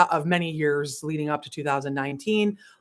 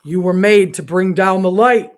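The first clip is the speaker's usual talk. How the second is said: louder, declaring it; proclaimed loudly; 10 dB louder than usual. Retold or imitated. Repeating God's message to her listeners, loudly proclaimed. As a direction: ring it out like a revelation.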